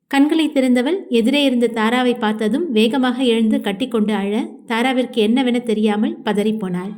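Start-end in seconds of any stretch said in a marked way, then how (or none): none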